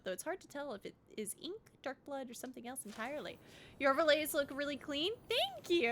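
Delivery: ordinary delivery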